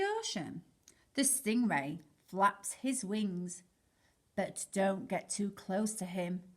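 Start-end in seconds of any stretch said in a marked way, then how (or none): none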